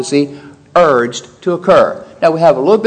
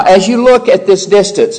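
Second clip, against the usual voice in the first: ordinary delivery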